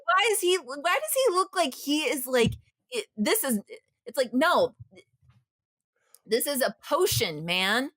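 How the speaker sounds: worked up, pitch climbing high; agitated. Real, not acted. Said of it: "I'm getting worked up right now".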